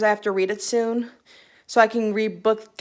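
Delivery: ordinary delivery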